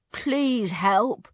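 high, loud urgent plea; begging. A narrator's reading. Dad to the old wizard, begging. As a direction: plead earnestly for help. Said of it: "begged her dad".

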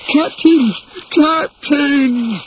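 high, desperate cry; Smee in despair. A narrator's reading. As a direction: call out in despair for the captain. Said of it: "cried Smee in despair".

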